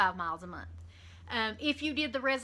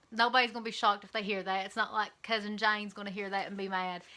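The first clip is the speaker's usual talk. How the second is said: ordinary delivery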